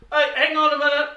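loud, high call of a pool lifeguard; mock lifeguard call. Mocking pool lifeguard calls out to swimmers, loud and high. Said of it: "What do they do?".

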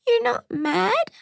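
put-on tiny, very high, slow child voice; tearful little girl. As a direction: ask timidly like a child still upset. Tearful child asks mother.

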